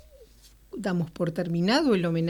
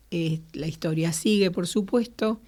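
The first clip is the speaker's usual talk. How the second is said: ordinary delivery, nothing marked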